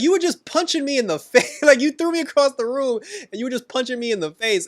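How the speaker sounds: laughing, high-pitched exasperation; incredulous outburst. Real, not acted. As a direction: yell it in amazed disbelief. Incredulous rant at Ronnie's hypocrisy.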